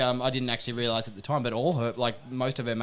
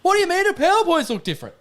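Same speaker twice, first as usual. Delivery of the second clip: high, loud baffled question; surprised; 7 dB louder than usual. Retold or imitated. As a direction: sound totally baffled. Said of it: "and was like".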